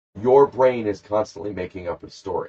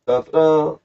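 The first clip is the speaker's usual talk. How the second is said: dramatic dun dun dun; 8 semitones higher, 7 dB louder, slower than usual. Pretend. Dramatic boat arrival sound.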